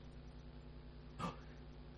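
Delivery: sharp breathy intake of surprise; mock gossip's gasp; shocked gasp. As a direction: gasp at the juicy news. Gossip reacting.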